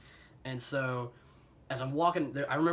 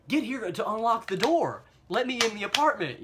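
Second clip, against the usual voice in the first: higher and louder, frantic pleading; desperate plea; 9 semitones higher, 7 dB louder than usual. Retold or imitated. To girlfriend on phone, urging her home, frantic plea. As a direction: beg her to hurry, voice up and pushing.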